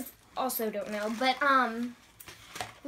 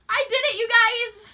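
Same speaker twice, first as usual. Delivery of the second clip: high, loud triumphant cheer; excited; 11 semitones higher, 7 dB louder than usual. Real, not acted. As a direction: celebrate finishing the challenge. Finished swallowing the beans.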